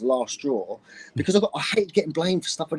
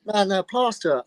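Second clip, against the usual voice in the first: ordinary delivery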